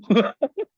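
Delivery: short loud high laugh. Real, not acted. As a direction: burst out laughing at your own joke. Laughs after joking about school. (크게 터뜨리는 짧은 웃음)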